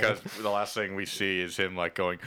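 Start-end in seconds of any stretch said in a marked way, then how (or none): none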